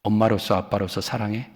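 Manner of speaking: a hushed, sincere question; soft, quiet parent's question. Pretend. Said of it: "자식이 말을 안 들어. 그러면은".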